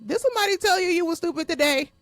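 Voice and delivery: mock them through laughter; laughing taunt. Real, not acted. Mocking bullies, laughing.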